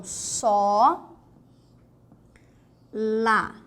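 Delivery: "só" held long and rising, a pause, then "lá"; open strong O. Shown as a demonstration. Teacher sounding out sola, open strong O. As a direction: stretch the open o of "só" before a separate "lá". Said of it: "ele é o som aberto e forte".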